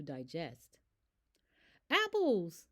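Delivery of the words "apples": bright excited cheer, high and loud; happy cheer. A narrator's reading. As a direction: cheer it out like you found treasure. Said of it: "I cheered".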